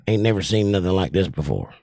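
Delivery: ordinary delivery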